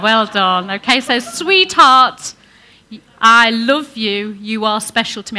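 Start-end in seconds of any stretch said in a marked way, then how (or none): 1.2-5.4 louder, slowed, drawn-out "I love you"; loving declaration. Shown as a demonstration. Preacher leading men to say it, slow and loving. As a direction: lead the congregation in a loving line. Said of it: "I can't hear you".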